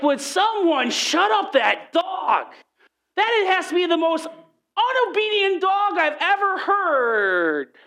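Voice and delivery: high, drawn-out mock yell; exasperated outburst. Mentor complains about barking dog during prayer, exasperated. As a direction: stretch every word in comic exasperation.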